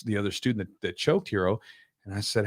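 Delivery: ordinary talk, nothing marked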